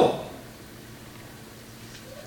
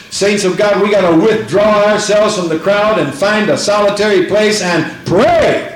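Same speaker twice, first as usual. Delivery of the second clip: ordinary talk, nothing marked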